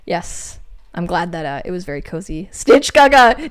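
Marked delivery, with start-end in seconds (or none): 2.5-3.5 "Stitch Gaga" called out loud and high; loud teasing nickname. Pretend. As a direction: yell the nickname to tease her. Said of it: "saying Gaga".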